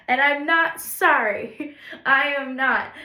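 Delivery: loud, slow, laughing defiance; playful defiance. Real, not acted. To the viewers about Family Guy clips, laughing defiantly. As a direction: insist on it with a grin.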